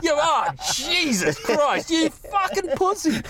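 high, slow, scornful inner voice; inner old-school voice. Retold or imitated. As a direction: sneer like the mocking voice in your head. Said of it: "old Nevo voice in the back of my head going".

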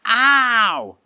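sudden high yelp of pain. Acted. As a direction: yelp like a struck ram. Ram struck between the horns.

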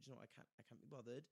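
muttered thought, giving up; inner thought. Retold or imitated. Thinking to herself about paying, quietly. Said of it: "and I thought".

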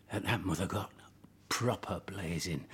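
dropped right down to a breath; hushed. Acted. Telling the hosts, suddenly hushed. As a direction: lean in and hush it.

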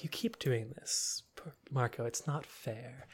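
mock tragic whisper to Marco; whispered lament. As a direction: breathe it like a hurt confession. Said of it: "Gina's like".

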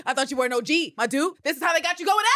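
loud, high, incredulous; rising disbelief, almost yelling; mocking outburst. Real, not acted. Mocking Constantine for getting caught, loud disbelief.

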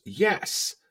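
high-pitched mock exasperated yes. Annoyed friend confirms who was on the phone, exasperated.

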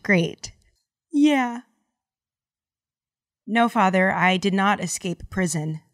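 ordinary delivery

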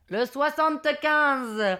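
slow, stretched number announcement; bingo caller. Retold or imitated. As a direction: announce it to the whole hall, drawn out. Monsieur Loyal announces the number. (annonce traînante de monsieur Loyal)

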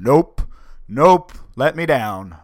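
loud, high panicked nope, drawn out slowly; yelling down to the boat in a panic, pleading; terrified plea. Retold or imitated. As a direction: beg to be let down, scared stiff. Terrified while rising into air.

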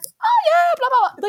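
very high, loud bubbly agreement; forced enthusiasm. Retold or imitated. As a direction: gush along to keep the chat alive. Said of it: "really trying to, like, sti-, keep this conversation going".

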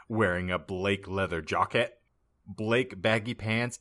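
plain reading voice

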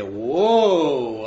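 loud, drawn-out whoa; imagine being startled by a talking donkey; mock astonishment. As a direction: pretend shock, belted out.

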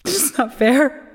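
slow, rising, near tears; tearful. Acted. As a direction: hold back the tears, slowly. Grieving sister breaks down.

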